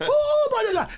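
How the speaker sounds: very high squealing ooh; mock mom squeal. Son imitating his mother selling shots, high squeal. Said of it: "you just".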